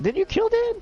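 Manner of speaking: jolted, squeaking high with shock. Real, not acted. GM to player about Dan, shocked and very high. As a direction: question leaps way up high.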